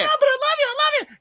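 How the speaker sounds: very high, whiny mock pleading; pleading Anna. Anna begging Elsa to love her, high whiny mock plea. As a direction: beg like a clingy little sister. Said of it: "and Elsa's like".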